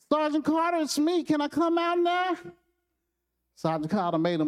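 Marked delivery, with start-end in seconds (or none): 0.1-2.3 put-on high, small child's voice; a timid kid asking to come out; little boy. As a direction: boyish and pitched high. Opie speaks from the locker.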